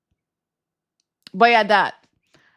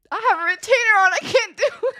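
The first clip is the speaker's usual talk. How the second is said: high, fast, loud whine breaking into laughter; retold protest; 11 semitones higher, 5 dB louder, faster than usual. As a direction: protest helplessly, cracking up. Acts out her protest to him.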